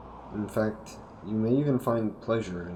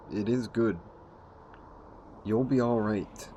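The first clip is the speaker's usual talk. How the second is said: ordinary talk, nothing marked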